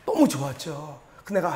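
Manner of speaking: usual speaking voice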